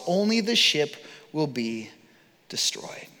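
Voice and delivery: slow, the last word breathed in a whisper; dramatic whisper. Acted. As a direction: let the final word vanish into a whisper. Paul's prophecy, dramatic ending.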